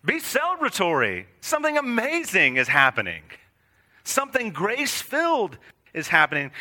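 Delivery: high, exultant call to rejoice; excited announcement. Retold or imitated. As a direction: proclaim it like thrilling news. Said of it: "It's like".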